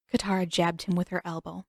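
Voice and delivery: usual speaking voice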